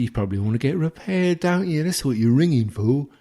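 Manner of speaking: high, fast, hammed-up condescension; mock patronising voice. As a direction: rattle it off like a smug phone agent. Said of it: "I said".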